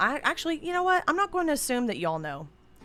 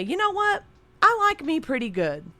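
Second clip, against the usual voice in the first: dad's cocky saying; 9 semitones higher, 4 dB louder than usual. Retold or imitated. Quoting dad's habitual saying.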